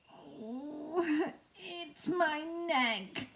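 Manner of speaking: a droopy giraffe's lament. Acted. Giraffe voice in story.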